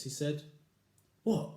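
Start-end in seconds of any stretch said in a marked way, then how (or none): 1.2-1.5 gasp. Acted. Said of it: "gasped Missus Twit".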